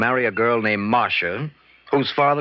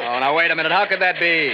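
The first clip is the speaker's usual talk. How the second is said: shouting over engine; 4 semitones higher than usual. Acted. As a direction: yell to be heard in the helicopter. Shouting over helicopter noise.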